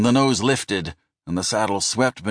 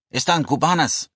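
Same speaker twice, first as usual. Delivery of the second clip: Spanish phrase; 9 semitones higher than usual. Retold or imitated. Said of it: "the man had explained".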